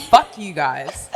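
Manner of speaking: mock defiance. Mimicking her swearing at the producers, a slow loud defiant curse. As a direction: drag it out defiantly.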